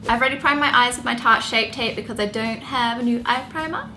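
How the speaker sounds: ordinary delivery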